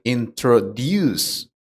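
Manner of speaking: "introduce" with the stress on the final syllable, in-tro-DUCE; stress at end. Shown as a demonstration. Teacher demonstrating English word stress, "introduce" stressed at the end. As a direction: lean on the last syllable of "introduce". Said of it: "pronounced with a stress at the end".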